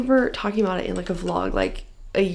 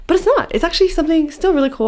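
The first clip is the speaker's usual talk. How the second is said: excited; 9 semitones higher, 6 dB louder, faster than usual. Real, not acted. Excited about other book.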